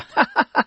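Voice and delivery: bright high laugh. A narrator's reading. Children share the man's joke, laughing. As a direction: laugh along, amused. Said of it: "Then we laugh too".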